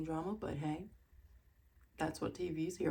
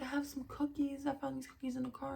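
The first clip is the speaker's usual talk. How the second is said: higher-pitched imitation of a friend; imitated awkward offer; 7 semitones higher, faster than usual. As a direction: offer it lightly in a higher, put-on voice. Voicing Sutton offering cookies awkwardly.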